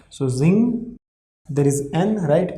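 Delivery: ordinary talk, nothing marked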